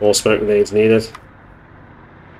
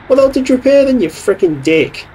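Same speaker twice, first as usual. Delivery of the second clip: high, loud outraged shout; exasperated yell; 5 dB louder than usual. Real, not acted. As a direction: blast the useless crew. Angry at useless repair.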